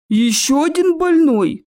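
slow, loud, high-rising troll question; surprised troll. Acted. Troll surprised by Nevil's water spell, booming. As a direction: boom it in heavy astonishment. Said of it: "удивился тролль".

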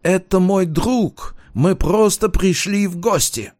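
high-pitched, urgent protest; crying out. A narrator's reading. Student explaining himself to armed police, high-pitched cry. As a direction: plead high in a put-on voice. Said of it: "выкрикнул он".

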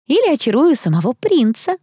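lightly voiced daydreaming girl; dreamy sigh. A narrator's reading. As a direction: muse wistfully about a romantic hope. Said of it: "мечтательно вздохнула Хелли".

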